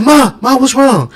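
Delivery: high, loud, frightened call to his mother; a boy bursting into the room, calling out in alarm. Retold or imitated. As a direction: rush in asking what's wrong. Son runs to crying mother.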